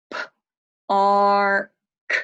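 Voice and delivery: "P-A-R-K" stretched, loud, sound by sound; sounding out park. Shown as a demonstration. Teacher sounds out park to the class. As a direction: sound out the word, loud and drawn. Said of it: "Sound it out".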